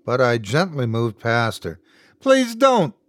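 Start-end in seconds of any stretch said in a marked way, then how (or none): 2.2-2.9 high, desperate plea; panicked pleading. A narrator's reading. Wife begging husband not to open the door, panicked and pleading. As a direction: beg him to stop, frightened. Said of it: "she pleaded from behind me through panicked sobs".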